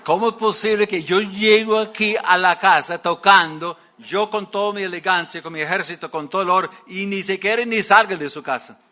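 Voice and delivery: loud, high indignant rant; furious. Retold or imitated. Naaman fuming outside Eliseo's house, loud furious rant. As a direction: shout out the wounded pride. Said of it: "me imagino que está todo fúrico".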